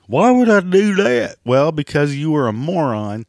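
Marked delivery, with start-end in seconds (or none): 0.1-1.4 high, loud mock voice of a whiny customer; high, loud mocking squeal of a customer who just doesn't get it; mocking whiny imitation. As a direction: squeal it like a clueless complainer. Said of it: "I know what you're saying".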